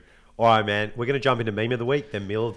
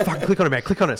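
excited urging; 6 semitones higher, 4 dB louder, much faster than usual. Retold or imitated. Ben urging him on the phone.

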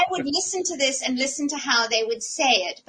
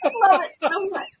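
higher, fast, through real laughter; laughing delight; 7 semitones higher, 6 dB louder, much faster than usual. Real, not acted. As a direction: gush while still laughing. Listener laughing at the story.

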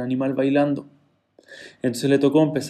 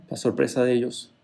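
usual speaking voice

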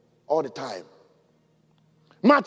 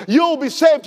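normal talking voice

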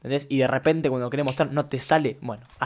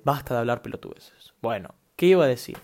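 usual speaking voice

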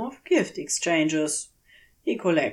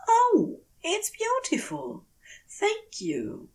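delighted thanks. Acted. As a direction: marvel at the gift, then murmur thanks. Thanks unseen giver for stone.

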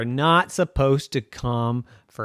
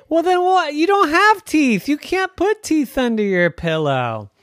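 put-on high, insistent kid voice; child arguing with daddy about teeth; 12 semitones higher, 3 dB louder than usual. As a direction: press the point like a little kid.

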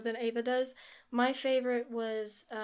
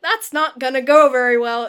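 much louder, half-acted dramatic line; mock dramatic outburst; 16 dB louder than usual. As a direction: blurt it out like a hammed-up confession. Retold Peeta line, loud burst.

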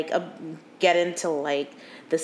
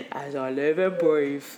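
lower, slow mock Cockney line; bad Cockney impression; 7 semitones lower than usual. Cohost echoes the trailer line in low mock Cockney. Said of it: "he sounds terrible".

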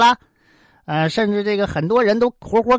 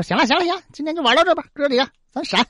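higher, hurried backing-off voice; nervous retreat; 7 semitones higher, much faster than usual. Acted. Scared suns quickly calling off the game, higher. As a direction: scramble away pretending it's nothing. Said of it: "这十个太阳也害怕呀".

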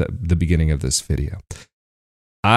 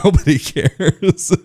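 laughing; 11 semitones higher, slower than usual. Real, not acted. Joking aside, laughing.

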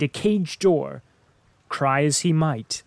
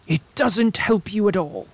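scornful witch, high; 6 semitones higher than usual. A narrator's reading. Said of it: "said the old woman".

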